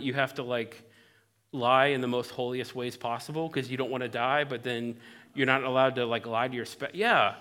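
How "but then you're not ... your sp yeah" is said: ordinary talk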